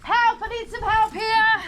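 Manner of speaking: shouted call for help. Pretend. First aid scenario calling for help.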